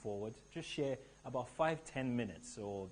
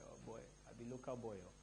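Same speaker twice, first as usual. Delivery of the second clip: Nigerian pidgin; 8 dB quieter, faster than usual. Retold or imitated. Speaker revealing his real Nigerian accent to the crowd, half-joking. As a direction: slip into a soft, lightly voiced Nigerian pidgin, the local boy underneath. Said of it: "I have to put up this accent".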